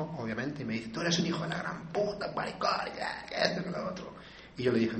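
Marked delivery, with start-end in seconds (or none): 0.9-4.1 higher-pitched imitation of an angry woman, trailing into near-whisper; mock angry rant. Child's mother cursing him at the door, mock angry. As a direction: rant in a raised pitch, then let the voice fizzle out. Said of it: "Y se enoja".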